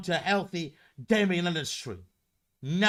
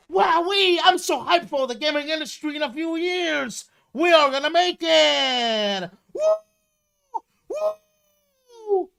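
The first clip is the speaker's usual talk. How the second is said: sarcastic over-the-top cheering, much higher and louder; mock hype; 11 semitones higher, 7 dB louder than usual. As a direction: ham up a clueless fan screaming with joy. Mock hyped gamer about industry.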